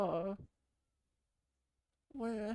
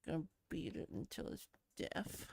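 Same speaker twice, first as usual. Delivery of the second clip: whisper; 6 semitones lower, 9 dB quieter, faster than usual. Pretend. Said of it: "beat it to death".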